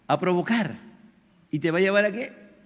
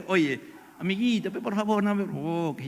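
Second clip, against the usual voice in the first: soft, overly polite little request; sweet-talking, hushed and ironic; mock gentle plea; 6 dB quieter than usual. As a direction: plead quietly, as if to a little friend. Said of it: "¿Así le dices?".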